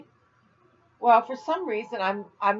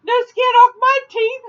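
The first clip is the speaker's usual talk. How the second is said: much higher and louder, bursting with laughter; laughing at own joke; 12 dB louder than usual. Real, not acted. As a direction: blurt it out while cracking up. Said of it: "I'm cracking up".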